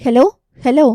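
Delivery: shouting hello into the phone; calling out hello. A narrator's reading. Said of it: "என்று அவன் கத்த".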